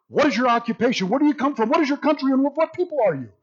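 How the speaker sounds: urgent, high-pitched grilling; rapid-fire interrogation. Acted. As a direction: fire the questions out without a breath. Sailors' rapid questioning of Jonah.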